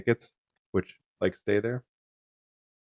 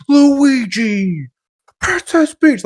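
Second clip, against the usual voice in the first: shrieking each name high and loud; excited name-shouting; 10 dB louder, much slower than usual. Retold or imitated. Mocking a streamer who shouts names at the screen. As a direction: yell out the characters like an excited kid. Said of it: "character goes".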